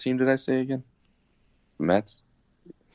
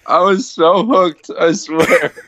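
talking through real laughter; giggling after falling for a fake ad; laughing; 4 semitones higher, 3 dB louder, slower than usual. Real, not acted. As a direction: laugh while you get the words out. Laughing after being fooled.

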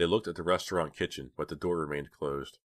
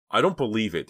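higher, louder wail of disbelief; 6 semitones higher, 7 dB louder than usual. A narrator's reading. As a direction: wail it in dismay. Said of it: "Dina wailed".

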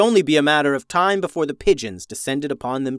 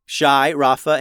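usual speaking voice

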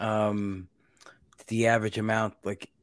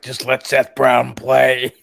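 loud, drawn-out fan's plea; mock yelling; 3 semitones higher, 9 dB louder, much slower than usual. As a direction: yell it slowly, as if at the team's manager. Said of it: "I'm always yelling".

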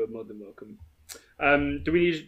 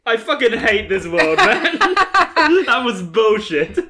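laughing exasperated; 8 semitones higher, 8 dB louder, slower than usual. Real, not acted.

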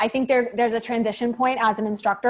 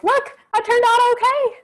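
much higher, perky put-on voice; high mock self voice; 4 dB louder than usual. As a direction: chirp it like a proud little note. Quoting her own past note.

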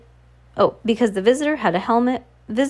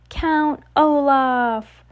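higher, drawn-out announcer's flourish; grand announcement; 5 semitones higher, much slower than usual. A narrator's reading. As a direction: present the villain to the crowd, very slowly. Officer presents captive to crowd.

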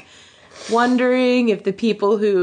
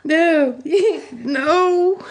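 laughing; 9 semitones higher than usual. Real, not acted. Breaks down laughing.

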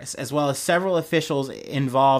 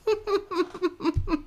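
high burst of laughter; 6 dB quieter than usual. Real, not acted.

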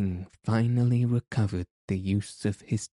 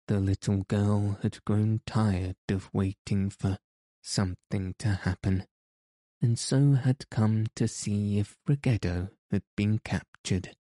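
plain reading voice

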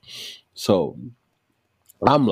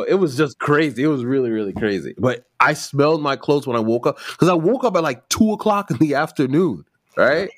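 ordinary delivery

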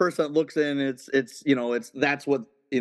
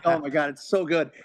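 ordinary delivery

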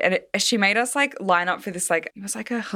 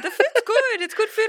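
very high, laughing food raptures; squealing food delight. Retold or imitated. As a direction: gush over the food in a squeal. Imitating City's vocal eating.

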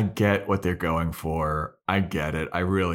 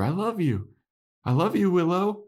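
high-pitched, imploring imitation; mock declaration of love; 12 semitones higher than usual. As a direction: act out a hammed-up loving plea. Retold repeated pleading love declaration.

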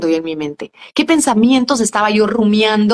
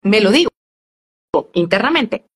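ordinary talk, nothing marked

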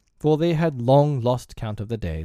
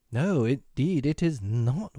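high, emphatic "No, indeed, it is not"; emphatic denial; 4 semitones higher, slower than usual. A narrator's reading. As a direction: lean hard on "No, indeed, it is not". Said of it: "with frightful emphasis".

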